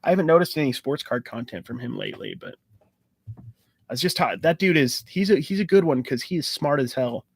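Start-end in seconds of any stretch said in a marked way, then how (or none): none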